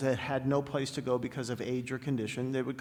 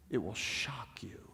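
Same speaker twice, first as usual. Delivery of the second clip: slow, soft whisper; 4 semitones lower, 8 dB quieter, slower than usual. Shown as a demonstration. Speaker telling the audience about the homework, whispered. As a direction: lean in and whisper the promise. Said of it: "repeated for effect".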